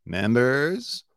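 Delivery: "Members" climbing high like a question; imitating rising uptalk. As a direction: draw out "Members" into a steep upward slide. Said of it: "it's the way his voice goes up".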